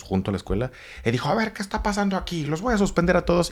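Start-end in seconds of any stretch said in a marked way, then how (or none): 1.3-3.5 high-pitched mock prefect voice barking at the kids; lightly hammed-up authority catching them; stern school prefect. School prefect breaks up fight.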